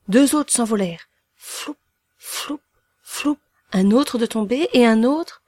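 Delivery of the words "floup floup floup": short, airy floup noise; whoosh of flying hat. A narrator's reading. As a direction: puff it out like a hat whisked away. Hats fly off in succession. (bruit soufflé de chapeau envolé)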